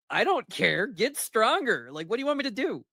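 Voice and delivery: high, careless "I don't care" voice; dismissive shrug. Retold or imitated. The Viking waves off Ahmed's complaint, dismissive. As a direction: brush the whole problem off. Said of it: "It's like".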